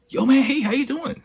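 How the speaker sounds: high-pitched, eager friendly greeting; over-the-top chummy hello; super friendly. Retold or imitated. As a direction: imitate an overly friendly stranger, voice way up. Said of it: "he's super friendly".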